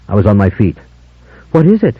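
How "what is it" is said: high, startled cry of alarm; cried out. A narrator's reading. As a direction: she cries out, frightened and high. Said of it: "she cried".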